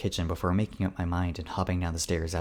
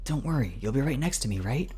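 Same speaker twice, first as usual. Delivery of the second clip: higher, coaxing reassurance; reassuring higher voice; 6 semitones higher than usual. Acted. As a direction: talk him into it gently. Carl reassures.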